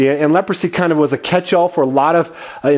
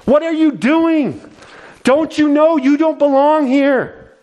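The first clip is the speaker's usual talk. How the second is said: angry crowd; 11 semitones higher than usual. Acted. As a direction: shout them down as an outsider. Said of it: "other people are angry".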